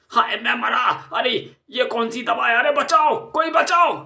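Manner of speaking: screaming for help while beaten; cries of pain. Acted. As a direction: howl as each blow lands. Thug beaten with a stick.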